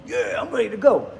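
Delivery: fired up by a gym song; mock pumped gym voice; hyped up. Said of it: "Certain track comes on".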